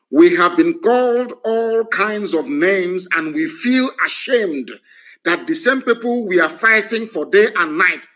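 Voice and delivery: slow, emphatic word-for-word quote; verbatim quote. Retold or imitated. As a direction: read out his exact words slowly and deliberately. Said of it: "I'm going to quote him verbatim".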